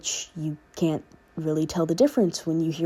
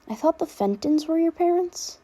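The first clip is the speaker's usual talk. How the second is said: put-on higher filtered girl voice, puzzled; confused clone girl; 10 semitones higher than usual. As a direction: ask it puzzled through the filter. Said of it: "confusion lacing her voice".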